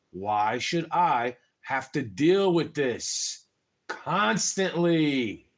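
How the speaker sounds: slow, deliberate calm question; calmly masking anger, drawn out slowly. Retold or imitated. To his mom, asking slowly and very calmly. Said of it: "I used to say it very calmly".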